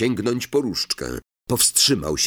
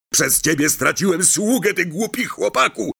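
furious; 9 semitones higher than usual. Acted. Malfoy lunges at Harry.

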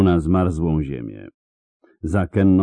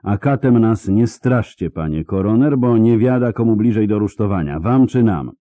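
higher, louder defiant jab; bold, raised defiance; defiant retort; 6 semitones higher, 6 dB louder than usual. Acted. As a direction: throw the threat right back. Kenna defies the coroner.

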